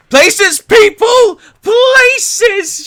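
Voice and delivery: very high, very loud panicked yell; shrill frantic stage call; frantic shout. Acted. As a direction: scream the call like a stressed producer. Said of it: "freaking the hell out".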